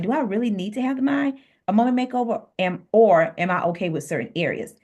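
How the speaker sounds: ordinary delivery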